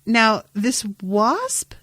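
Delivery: usual speaking voice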